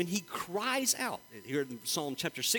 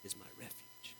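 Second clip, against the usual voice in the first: quiet, reverent declaration of trust; Christ speaking; 2 semitones lower, 19 dB quieter than usual. Retold or imitated. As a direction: say it softly, almost under the breath. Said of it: "And Christ said".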